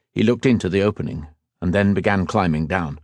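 usual speaking voice

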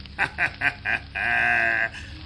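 soft sinister chuckle; quiet villain chuckle. Acted. Villain laughs.

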